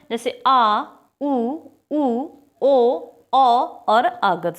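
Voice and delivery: आ उ ओ औ sounded out slowly and separately; back vowel demo. Shown as a demonstration. Teacher showing students the back vowels, slow and drawn out. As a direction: stretch each vowel आ, उ, ओ, औ so the class hears it. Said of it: "बोल के देखिए".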